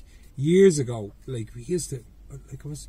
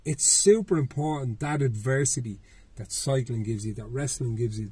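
usual speaking voice

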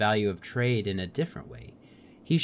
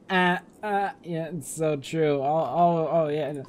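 laughing agreement; 5 semitones higher than usual. Real, not acted. Player laughing at the companion's joke, laughing.

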